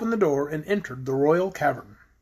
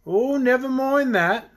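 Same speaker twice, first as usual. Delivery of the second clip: higher, drawn-out, dismissive dragon; dragon character; 6 semitones higher, slower than usual. A narrator's reading. Dragon waving away his chains, slow and dismissive. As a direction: brush it off slowly as the dragon.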